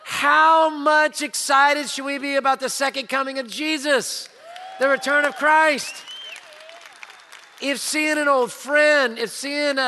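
rousing sermon climax; preaching. Real, not acted. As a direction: build it to a rousing cry. Preaching climax on Christ's return.

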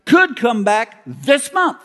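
loud, high, slow proclamation; dramatic preacher. Retold or imitated. Evangelist proclaiming the second coming, loud and slow. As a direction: thunder it like a revival preacher. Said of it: "And he said".

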